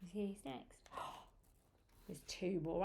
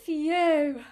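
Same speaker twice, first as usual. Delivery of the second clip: mock relief; 5 semitones higher, 8 dB louder than usual. Relief that frog got away.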